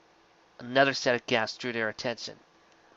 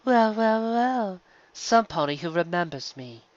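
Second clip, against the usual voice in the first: higher, drawn-out amused purr; amused villain; 6 semitones higher, slower than usual. A narrator's reading. Nightmare Moon toys with Twilight, high amused villain voice. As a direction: savour it, smug and amused. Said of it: "seemed amused".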